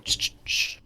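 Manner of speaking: voiceless repeated "shh"; hushed attention-getting hiss. Acted. Player sneakily signaling a man below, hushed shushing. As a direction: hiss softly to get someone's attention. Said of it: "whisper to him or just go".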